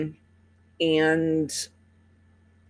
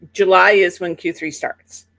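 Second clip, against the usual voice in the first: normal talking voice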